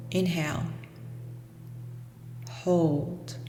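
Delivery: ordinary talk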